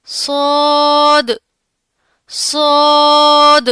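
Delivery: صاد drawn out slowly with a full mouth, loud; full-mouthed saad. Shown as a demonstration. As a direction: fill the mouth and hiss on صاد. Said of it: "منہ بھر کر اسے ادا کرنا ہے". (منہ بھر کر صاد)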